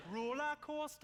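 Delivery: "Roller coaster" high in Geordie; Geordie accent. Shown as a demonstration. Comedian demonstrating trigger words to the crowd, high Geordie accent. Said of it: "trying to do the Geordie accent".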